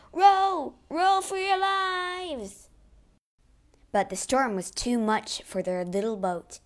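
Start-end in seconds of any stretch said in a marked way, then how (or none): 0.1-2.7 loud, slow desperate shout. Acted. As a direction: bellow it over the storm. Sailors fleeing sinking ship.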